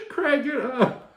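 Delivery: high, loud imitated yell; Regina King. Repeating the Friday line to fallen Craig, hammed-up yell. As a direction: holler it hammily between laughs.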